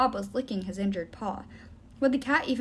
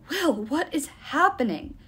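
slow, higher question, lightly winded; panting; 5 semitones higher, slower than usual. A narrator's reading. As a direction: ask as if catching your breath. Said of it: "Nico asked, panting".

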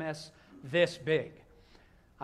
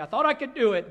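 high, loud confession bursting out; anguished cry; 8 semitones higher, 12 dB louder, faster than usual. Acted. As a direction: cry it out as a guilt-ridden prayer. Confessing prayer, voice peaks.

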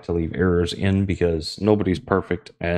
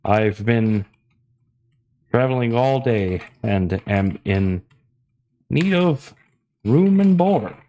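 roleplay traveler voice; 3 semitones higher, slower than usual. Acted. Tired traveler asking the barkeep for a room, theatrical adventurer voice. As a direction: stretch it out slowly like a theatrical fantasy traveler. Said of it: "we're going to respond with".

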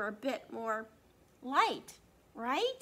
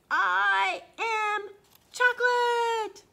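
slow, much higher and louder, triumphant; drawn-out proud cry of chocolate; proud declaration; 10 semitones higher, 12 dB louder, much slower than usual. Acted. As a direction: announce it like the big reveal. Character proudly declares who he is.

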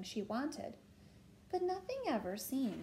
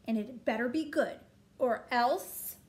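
shouted demand; 3 semitones higher, 7 dB louder than usual. A narrator's reading. Princess threatening her parents over ice cream, shouting. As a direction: demand it loudly, word by word. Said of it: "she shouted".

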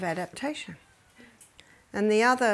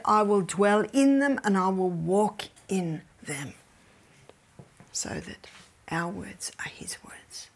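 awed whisper of scripture. Acted. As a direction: hush the voice for God's words. Quoting God reverently.

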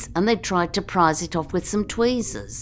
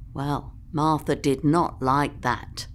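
usual speaking voice

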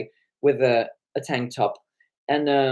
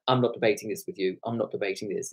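low, soft brush-off; retold low-voiced dismissal; dismissive refusal; 6 semitones lower, 6 dB quieter, faster than usual. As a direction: shut it down quietly and quickly.

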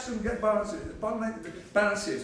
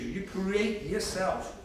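slow emphatic; much slower than usual. Acted.

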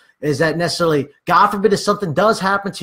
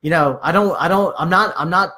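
usual speaking voice

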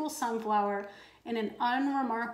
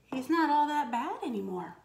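ordinary delivery, nothing marked

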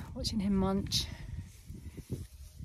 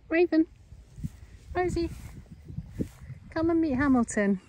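calling out; 8 semitones higher, 8 dB louder than usual. Real, not acted. Calling animals across a field.

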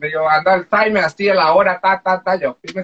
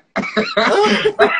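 a much higher, bright burst of laughter; real laugh; 14 semitones higher than usual. Real, not acted. Laughing at her own story. (risa real y mucho más aguda)

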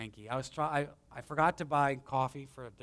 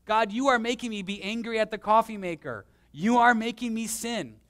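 raised, louder pretend accusation, whining at God; hammed-up mock prayer of blame, higher and louder; mock whiny complaint to God; 6 semitones higher, 5 dB louder than usual. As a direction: play the sinner blaming God, half in jest. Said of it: "no one should say and blame God, says".

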